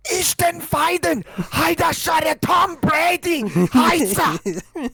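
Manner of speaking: high fake German shouting, slow; shouting Hitler speech. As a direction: bark mock German at a rally, slowly. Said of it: "his next speech, he'd be like".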